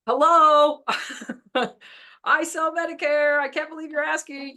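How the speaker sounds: high, loud, fed-up mother's voice with a laugh breaking in; exasperated mom. Retold or imitated. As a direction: play the fed-up mother who can't believe they're asking. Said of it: "she was so frustrated".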